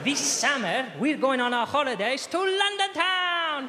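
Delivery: put-on excited holiday pitch in Spanish accent. Spanish father telling son about London trip, Spanish accent. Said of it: "Spanish accent".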